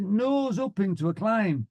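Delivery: high, drawn-out order; instructor's command. Retold or imitated. Flying instructor ordering a climb, instructor's command. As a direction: call it out high and slow.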